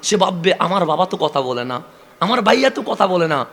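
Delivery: fervent sermon build; impassioned preaching. Acted. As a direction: lift the congregation with the child's vow. Preacher swells again.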